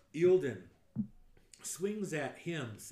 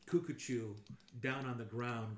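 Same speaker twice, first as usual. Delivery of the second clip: ordinary talk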